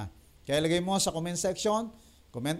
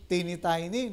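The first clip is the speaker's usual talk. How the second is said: usual speaking voice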